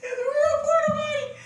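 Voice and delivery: high laughing disbelief. Real, not acted. Amazed at the potty, high and laughing.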